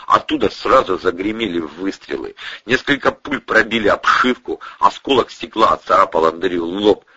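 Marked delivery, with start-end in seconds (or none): none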